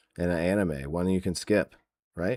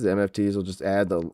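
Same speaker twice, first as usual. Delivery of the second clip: ordinary talk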